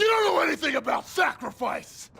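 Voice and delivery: very high, loud enraged yell; furious shout. Acted. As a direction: explode at him. Heated argument between soldiers.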